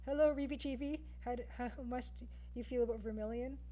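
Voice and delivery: ordinary delivery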